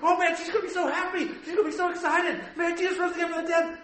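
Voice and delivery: high, giddy put-on angel chatter; excited angels. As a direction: bubble over with imitated excitement. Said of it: "how much excitement do you think these angels have".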